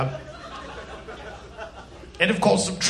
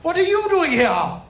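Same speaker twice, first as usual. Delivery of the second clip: startled question; 6 semitones higher than usual. Acted. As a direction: ask it higher and surprised. Repeats question, now to Jesus.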